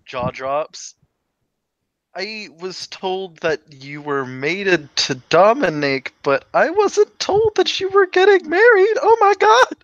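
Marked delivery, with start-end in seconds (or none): 2.2-9.8 shrieking high with surprise; high-pitched shocked squeal. Acted. Caroline shocked at wedding news.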